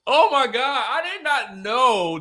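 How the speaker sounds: laughing loudly through the words; real, bright burst of laughter; cracking up for real. Real, not acted. Laughing at the text message.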